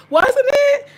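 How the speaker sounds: loud, high, drawn-out burst; excited exclamation. Real, not acted. Agreeing with viewers that Frank was nice to have, excited high exclamation.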